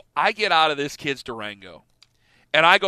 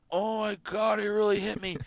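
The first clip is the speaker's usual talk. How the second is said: softer, dazed disbelief; quiet shock after a punch; stunned hush; 4 semitones higher, 8 dB quieter than usual. Retold or imitated. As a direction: murmur it like the world slowed down. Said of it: "I'm going".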